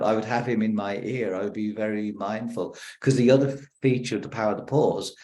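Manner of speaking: ordinary talk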